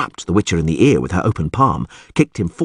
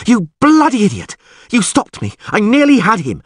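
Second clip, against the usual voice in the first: high, loud enraged yelling; furious yelling; 12 semitones higher, 5 dB louder than usual. A narrator's reading. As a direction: scream it, livid. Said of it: "Yennefer yelled".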